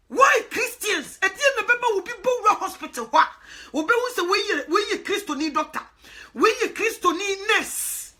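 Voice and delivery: high, loud, slow challenge; fervent preaching. Real, not acted. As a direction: thunder it from the pulpit. Preaching at full force.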